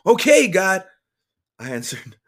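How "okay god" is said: loud, high answer to God; raised-voice surrender; emotional assent. A narrator's reading. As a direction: give in, calling upward. Answering God's command aloud.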